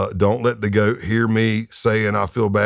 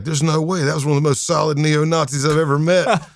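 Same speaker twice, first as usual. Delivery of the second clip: higher, lightly acted incredulity; retold disbelief; 7 semitones higher than usual. A hint of a case team member who won't believe the news. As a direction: scoff like you can't believe it.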